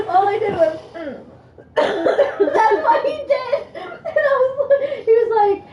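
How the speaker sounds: high, loud, slow laughing speech; laughing through words. Real, not acted. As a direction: talk through tears of laughter. Laughing retold story.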